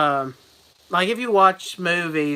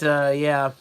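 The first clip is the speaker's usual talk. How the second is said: ordinary talk, nothing marked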